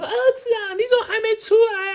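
hammed-up shrill mother yelling; frantic mother. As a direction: panic at the son about the fire. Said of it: "他说".

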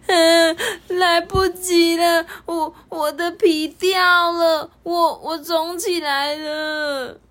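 high, slow, tearful wail; a child crying in panic. Acted. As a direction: sob the words out. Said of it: "天米却开始哭起来了".

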